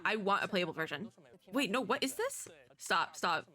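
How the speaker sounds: usual speaking voice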